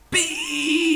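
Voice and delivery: very high squeal of a word; mock shout. A narrator's reading. Mother shrieking about beans. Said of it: "instead of shouting".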